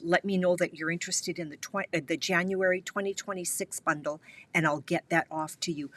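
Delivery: ordinary talk, nothing marked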